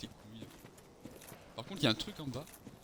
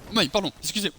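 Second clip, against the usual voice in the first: ordinary talk, nothing marked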